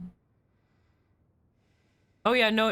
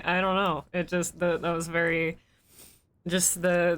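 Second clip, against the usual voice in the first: ordinary talk, nothing marked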